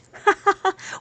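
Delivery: high-pitched laugh; short, high burst of laughter. Real, not acted. Laughs while greeting a viewer.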